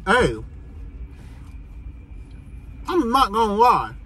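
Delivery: chewing between words; talking while chewing. Real, not acted. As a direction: mouth partly full. Seen 1: Bite near -4; pursed, puffed cheeks chewing throughout; burger held.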